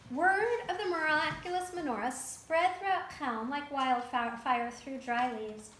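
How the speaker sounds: usual speaking voice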